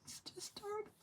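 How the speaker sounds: broken whisper, barely any voice; choked by real tears, almost silent; whispered through crying; tearful whisper. Real, not acted. Breaks down after long pause.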